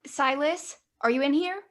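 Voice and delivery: high, loud call through a door. Acted. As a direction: call him from outside the door. River calling through the door.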